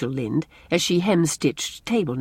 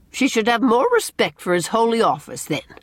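higher, mildly indignant comeback; raised, insistent retort; 8 semitones higher, 4 dB louder than usual. A narrator's reading. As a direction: answer back with a touch of reproof. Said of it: "retorted Missus Rachel".

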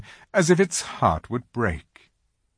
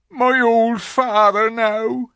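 loud, high, slow lament; wailing faun; 13 semitones higher, 7 dB louder, slower than usual. A narrator's reading. Faun confessing to Lucy about his father, wailing. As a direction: wail it with drawn-out words.

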